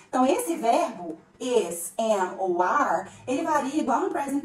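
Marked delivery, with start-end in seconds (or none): none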